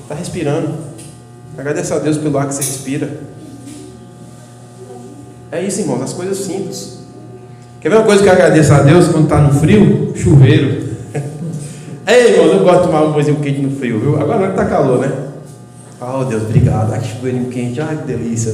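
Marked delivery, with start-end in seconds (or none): 0.1-7.0 gentle hushed words while breathing out; soft and hushed. Shown as a demonstration. Preacher guides the congregation through a breath, hushed. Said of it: "Respira, vai, bem forte". 16.0-18.5 mock shower bliss. Enjoying a hot shower.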